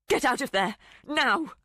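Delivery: loud, high command; angry. A narrator's reading. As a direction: order him out, furious. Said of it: "couldn't restrain her anger".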